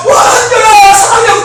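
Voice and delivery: high, gushing fan-service squeal. Acted. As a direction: play an idol calling out her love. Voices idol [name] greeting the boy.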